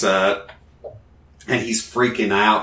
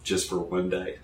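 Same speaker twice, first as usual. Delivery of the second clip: usual speaking voice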